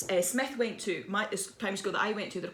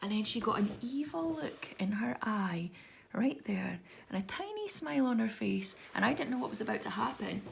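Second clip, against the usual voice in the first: sinister hush; 7 dB quieter than usual. Retold or imitated. Author describing the dinner lady's evil look, sinister hush. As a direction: drop to an ominous storyteller's whisper. Suspense before the third scoop.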